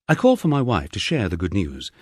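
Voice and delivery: ordinary delivery, nothing marked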